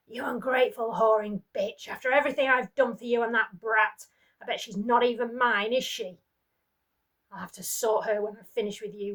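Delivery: loud, raging abuse; the husband rages at his wife over the child; furious rant. Acted. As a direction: spit the insults at her.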